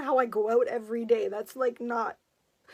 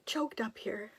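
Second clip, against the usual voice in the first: tears take the voice away to a whisper; choked-up whisper; 8 dB quieter than usual. Real, not acted. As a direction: fight the tears, barely whispering. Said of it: "I'm getting kinda choked up".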